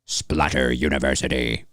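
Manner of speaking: lightly voiced grindhouse announcer; grindhouse trailer voice. Shown as a demonstration. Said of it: "I would do something more like".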